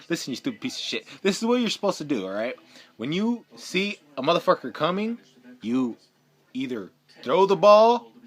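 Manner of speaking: loud, exasperated telling-off; angry scolding. Real, not acted. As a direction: chew out a player who blew it. Scolds quarterback over seven sacks.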